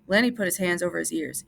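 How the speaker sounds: usual speaking voice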